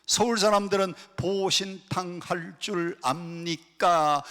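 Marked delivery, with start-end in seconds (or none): 1.2-4.2 slow, syllable-by-syllable Seoul question; drawn-out imitation of careful Seoul speech; Seoul speaker. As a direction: spell it out slowly, beat by beat. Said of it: "여덟 글자로 묻는데".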